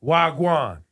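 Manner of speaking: usual speaking voice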